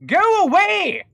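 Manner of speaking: 1800s inventor voice. Acted. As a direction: shoo it off like an indignant robber baron. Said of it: "I'm stuck in the accent".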